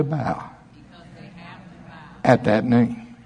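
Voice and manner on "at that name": usual speaking voice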